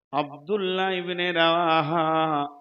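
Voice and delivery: normal talking voice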